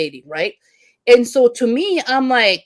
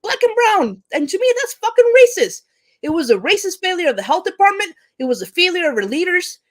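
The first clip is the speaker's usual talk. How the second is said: real anger, pitch shooting much higher; really angry; 7 semitones higher than usual. Real, not acted. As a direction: let the outrage climb until the voice cracks up high. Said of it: "I get really angry about this".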